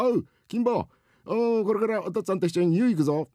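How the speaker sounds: high-pitched fatherly call. Acted. Father calls son to the bath, calling out. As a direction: call the boy over eagerly.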